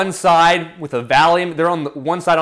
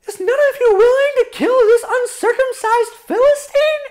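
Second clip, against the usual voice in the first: put-on high-pitched indignant boy voice; young David; 3 dB louder than usual. David challenging his older brothers, high indignant voice. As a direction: challenge them in a high, youthful squeak. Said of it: "David goes up to his brothers".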